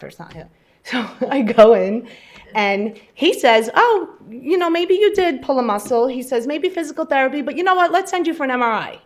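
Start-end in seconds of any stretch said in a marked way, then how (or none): 3.7-6.1 mock-breezy doctor, pitched high and loud; imitating cheery doctor. Patient mimics her doctor reassuring her, high and loud. 6.5-9.0 mock-breezy doctor, pitched high and loud; imitating cheery doctor. Patient mimics her doctor reassuring her, high and loud. Retelling doctor's casual suggestion.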